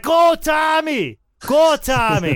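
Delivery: put-on slow, high, shouted cheer in a Boston accent; rowdy Revere fan in a Boston accent cheering Tommy on. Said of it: "he'll be like".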